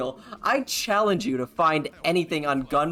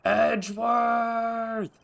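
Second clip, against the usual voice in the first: long drawn-out scream of the name, high and slow; Von Karma scream; 8 semitones higher, 4 dB louder, much slower than usual. Retold or imitated. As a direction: howl the name like a cornered villain. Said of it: "the signature Von Karma scream".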